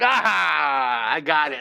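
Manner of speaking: long, drawn-out "aha" of discovery; triumphant aha. Real, not acted. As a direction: crow over the find at last. Finds the buried book.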